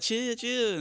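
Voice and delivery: high-pitched irritated brush-off; mock salty. Boyfriend fending off his girlfriend's phone complaint, mock salty. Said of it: "I get kinda salty".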